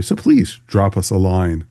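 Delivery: usual speaking voice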